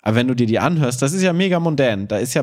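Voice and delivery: ordinary delivery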